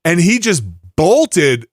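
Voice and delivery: usual speaking voice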